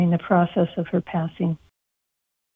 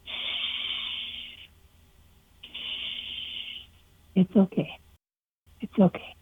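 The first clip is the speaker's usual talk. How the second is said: hushed, breathy shushing to calm a dying ewe; soft whispered comfort to a dying sheep; soothing whisper; 11 dB quieter, slower than usual. Retold or imitated. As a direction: whisper it like stroking her face. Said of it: "I whispered".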